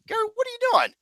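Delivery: high, startled call across the room; sudden high call of his name; surprised call-out. Real, not acted. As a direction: catch Gary in the act. Calling out to co-host.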